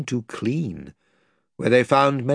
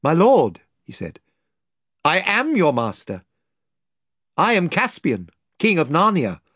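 higher, louder kingly reveal; royal declaration; 5 semitones higher, 3 dB louder than usual. Acted. Caspian revealing to the lord he is king, bold. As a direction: claim the throne boldly.

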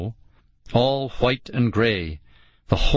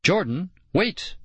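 higher-pitched call to stop; mild cry; 8 semitones higher than usual. A narrator's reading. Girl calls to Jordan to wait, crying out. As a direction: call out without real volume. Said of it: "Nicole cried".

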